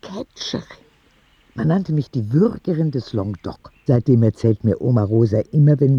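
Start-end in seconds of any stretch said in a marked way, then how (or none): 0.0-0.6 whispered, rising question; astonished whisper. Acted. Oskar whispers back his friend's old job, amazed. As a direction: breathe the word in disbelief.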